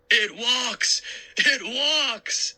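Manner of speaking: hammed-up high mock fright; mocking repeat. Uncle mockingly repeats his fake scream to the kids. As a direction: laugh at his own fake terror. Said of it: "he repeated".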